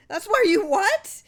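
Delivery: very high, very loud, slowed outrage; furious disbelief. Acted. Clarence shocked, furious.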